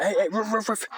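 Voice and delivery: playful imitated woofing; dog bark imitation. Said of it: "he was being like".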